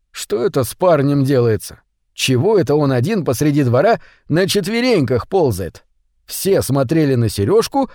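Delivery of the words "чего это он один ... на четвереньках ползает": usual speaking voice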